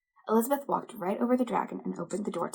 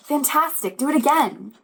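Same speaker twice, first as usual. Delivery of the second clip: higher, louder cheering; excited shout; 6 semitones higher, 8 dB louder than usual. Acted. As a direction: cheer him on to go again. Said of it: "but Elizabeth shouted".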